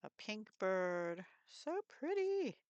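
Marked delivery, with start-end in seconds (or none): 1.5-2.6 high sing-song coo. Acted. Delighted over a paper piece.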